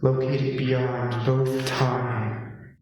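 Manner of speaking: lightly voiced grand announcer, slow; booming cosmic welcome, drawn out slowly; epic announcer voice. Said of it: "your epic announcer voice".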